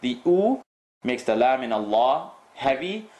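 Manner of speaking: ordinary delivery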